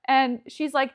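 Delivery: ordinary talk, nothing marked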